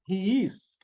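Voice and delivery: English "he is" said as a clear model. Shown as a demonstration. Teacher to students, modelling "he is" in English. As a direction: give "he is" in careful English sounds. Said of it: "E aí a gente tem o".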